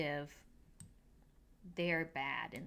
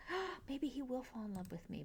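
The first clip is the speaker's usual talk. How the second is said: hopeful; 6 semitones higher, 5 dB quieter than usual. Retold or imitated. Penelope voiced hoping.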